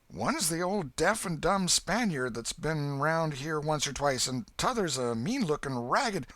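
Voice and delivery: put-on a breathless ragged country boy; Huck the boy. A narrator's reading.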